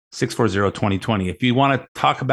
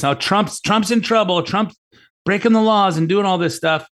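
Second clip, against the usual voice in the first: higher, breathless mock alarm; mocking worried kids; 8 semitones higher than usual. Host mimics kids or friends fretting that Trump's in trouble, higher. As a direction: ham up the panicked gossip. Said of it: "your kids or your friends are looking at this".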